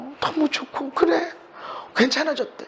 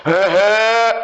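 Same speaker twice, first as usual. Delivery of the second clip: mock Chungcheong scolding grunt; drawn-out Chungcheong "ahem" of reproof; Chungcheong-style rebuke; slower than usual. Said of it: "우리 충청도 버전으로 하면".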